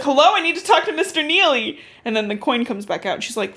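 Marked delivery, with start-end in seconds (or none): 0.0-1.8 higher-pitched, insistent caller; urgent girl on phone. Retold or imitated. Girl on a payphone asking for Mister Neely, urgent. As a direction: plead to be put through, pitched up.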